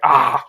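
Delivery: sudden loud pained cry; a loud startled yell of pain; pained yelp. Acted. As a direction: yelp as the steam hits you. Character cries out from steam.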